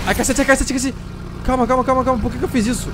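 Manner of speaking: real fright at a sudden attack; panicked yelps. Real, not acted. Gamer regretting turning on the generator, panicked yelps. Generator wakes a shooting enemy.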